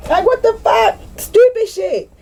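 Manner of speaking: very high-pitched mock outburst; exasperated squeal. Scolding herself for engaging, very high exasperated squeal.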